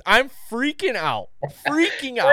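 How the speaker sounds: high, panicked retelling; freaking out. Retold or imitated. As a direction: climb up high in mock panic. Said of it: "you screaming in the back seat".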